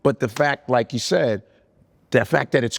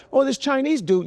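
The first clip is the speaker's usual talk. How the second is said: mock onlooker; 10 semitones higher than usual. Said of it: "You'll be like".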